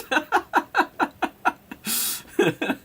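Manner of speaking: high-pitched cackling laugh; long burst of high, halting laughter; helpless laughter. Real, not acted. Commentator amused that the weak club scored, high laughter.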